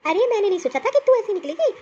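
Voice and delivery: ordinary talk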